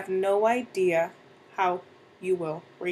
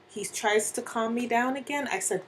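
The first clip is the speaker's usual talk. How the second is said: plain reading voice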